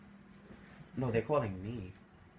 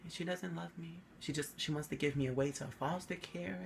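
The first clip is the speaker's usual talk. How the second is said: put-on much softer, pitiful innocent-kid plea; 3 semitones higher, 11 dB quieter than usual. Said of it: "I always had this innocent face like".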